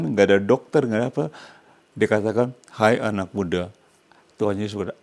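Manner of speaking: normal talking voice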